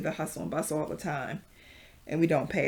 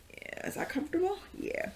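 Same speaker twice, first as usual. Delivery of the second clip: breathy high whisper, checking in; soft whisper; 13 semitones higher, 6 dB quieter than usual. Real, not acted. Yoga teacher whispering to her viewers, soft. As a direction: whisper it gently, like asking a friend if they're comfy.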